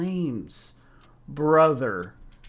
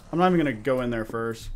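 ordinary delivery

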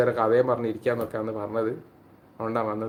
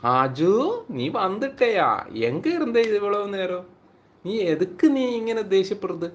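high-pitched, hammed-up Tamil-accented teasing; mock Tamil teasing; 9 semitones higher, 4 dB louder than usual. Teasing the sulking friend Haju, in a high mock Tamil voice. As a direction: put on a playful Tamil voice to tease her.

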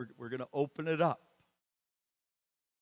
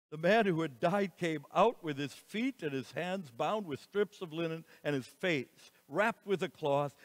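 ordinary delivery

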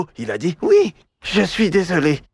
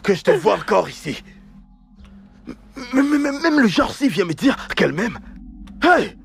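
angry shouting; 11 semitones higher than usual. Acted. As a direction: drive her out of the house, yelling. Husband chasing wife out.

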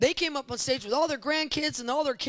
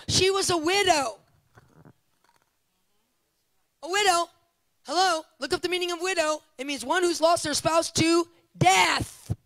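higher, louder preaching climax; preaching at full force; 6 semitones higher, 5 dB louder than usual. Real, not acted. Preacher to congregation, higher and louder at full force. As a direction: drive the point home to the congregation.

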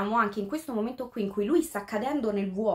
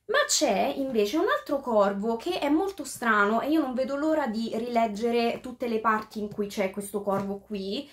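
ordinary talk

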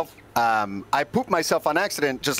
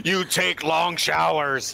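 slow, put-on monster line; mock monster voice; much slower than usual. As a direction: deliver it like a monster. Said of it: "he was".

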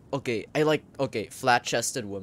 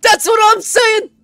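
high shrill shout of agreement; excited shriek; 15 dB louder than usual. Real, not acted. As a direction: shriek it out in triumph. Emphatic agreement outburst.

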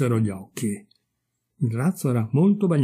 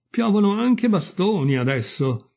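higher-pitched indignant surprise; startled goose exclaiming; 8 semitones higher than usual. A narrator's reading. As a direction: complain in astonishment. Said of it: "esclamò l'oca". (stupore indignato, voce più acuta)

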